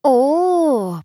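long, high, loud "oh"; drawn-out. A narrator's reading. Sister teases about how near he lives, drawn-out. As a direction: stretch out a teasing "oh". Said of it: "протянула Геля".

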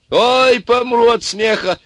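very high, loud, drawn-out howl; yelling with mirth. A narrator's reading. Ivan roars from inside the oven, yelling. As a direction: howl as if dying of laughter. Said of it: "орал Иван".

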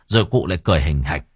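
usual speaking voice

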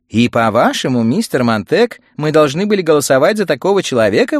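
loud, high challenge; indignant exclamation. Acted. Mrs Phelps challenges Montag over her vote, exclaiming. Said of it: "воскликнула миссис Фелпс".

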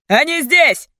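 loud, high warning cry; alarmed shout. A narrator's reading. Captor running onto the roof, alarmed shout. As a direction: burst in shouting the news.

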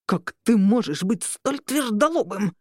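forceful growled reproach; angry, emphatic outburst in a changed voice; exasperated growl. A narrator's reading. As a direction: growl in frustration at a stubborn rival. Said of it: "зарычал Роберт".

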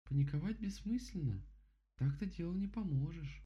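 высокий голос рассудительной медведицы; успокаивай высоким игрушечным голосом; рассудительная сказочная Мишка. Acted. Голос Мишки. (рассудительно, голосом Мишки)

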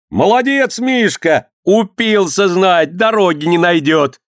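high, stretched-out cheer; crowd's jeering shout. A narrator's reading. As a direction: call out high, drawing out the words. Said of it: "кричали". (высокий протяжный выкрик толпы)

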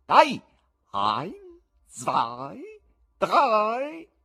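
loud, spaced-out showman's count; commanding count-in. A narrator's reading. Showman cueing his cat to pounce, loud count-in. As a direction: cue the trick, ringing out the last number.

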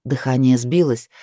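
usual speaking voice

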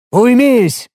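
sharp high barked command; barking shout. A narrator's reading. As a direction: snap it out as a command. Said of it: "рявкнул я".

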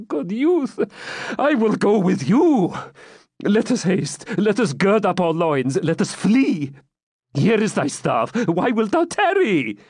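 put-on anxious, hurrying plea of a frightened old man. A narrator's reading.

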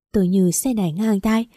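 ordinary delivery, nothing marked

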